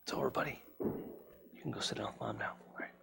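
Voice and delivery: whispering. Real, not acted. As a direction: whisper it gently. Whispering to a child.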